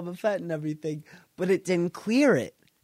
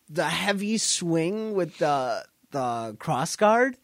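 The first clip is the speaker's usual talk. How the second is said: ordinary talk, nothing marked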